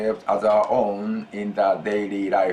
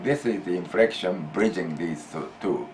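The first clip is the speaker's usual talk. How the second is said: usual speaking voice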